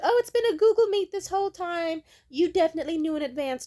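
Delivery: high, loud, quick fake-innocent voice; gaslighting manager. Mocking the manager gaslighting her, high, loud and quick. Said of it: "type energy".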